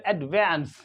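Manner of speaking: एडवांस as "ud-VANS", "ae" as in cat; American pronunciation. Shown as a demonstration. Teacher showing how Americans say it, ae vowel. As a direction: open "ae" in the second syllable of एडवांस. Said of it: "American English में क्या करते हैं".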